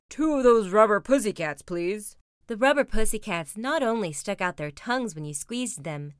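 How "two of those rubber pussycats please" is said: lightly voiced sheepish dad; embarrassed Papa Bear. Papa asking the toy seller, mildly embarrassed delivery. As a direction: start loud, drop off on please. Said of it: "said embarrassed Papa".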